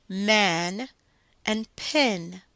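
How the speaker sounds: man drawn out very slowly, then pin; slow contrast words. Shown as a demonstration. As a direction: say the pair slowly so they can compare. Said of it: "going to say two words".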